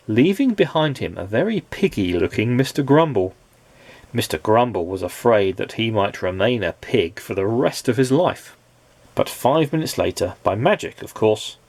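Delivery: usual speaking voice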